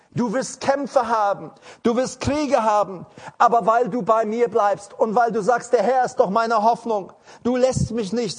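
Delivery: full-force pulpit delivery; fiery preaching. Acted. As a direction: preach it out to the congregation.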